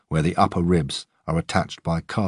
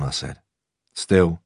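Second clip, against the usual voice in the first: usual speaking voice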